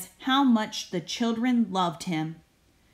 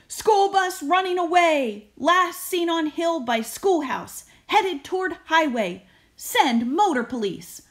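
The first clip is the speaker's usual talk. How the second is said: higher, louder clipped radio call; urgent police report; 7 semitones higher, 5 dB louder than usual. Acted. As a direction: report it like a dispatch. Policeman phoning headquarters.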